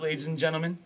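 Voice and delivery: normal talking voice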